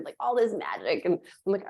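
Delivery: ordinary talk, nothing marked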